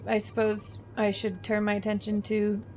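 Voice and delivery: usual speaking voice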